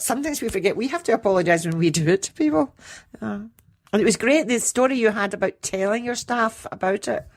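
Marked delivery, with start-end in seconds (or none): none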